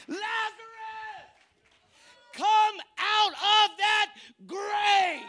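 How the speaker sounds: high, very slow calling shout; commanding shout. Acted. Preacher as Jesus, calling Lazarus in a slow shout. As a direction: call him out of the tomb. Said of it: "He shouted".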